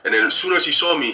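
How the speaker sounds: ordinary talk, nothing marked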